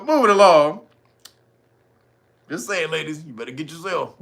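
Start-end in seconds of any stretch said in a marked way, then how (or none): none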